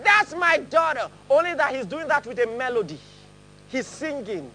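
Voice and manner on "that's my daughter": high, slow, loud proud exclaim; proud God. Pretend. Preacher voicing God rejoicing over a child, proud and loud. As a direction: beam it like a proud father. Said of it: "So God is like".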